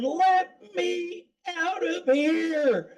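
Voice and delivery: high, drawn-out retold wail; imitating a freaked-out inmate's cry; frantic plea. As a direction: wail it like a man shaking the bars. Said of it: "he's shaking that door like this. He's going".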